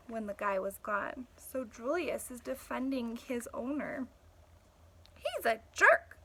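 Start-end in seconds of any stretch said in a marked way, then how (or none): 5.1-6.2 blunt retort. Acted. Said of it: "I said".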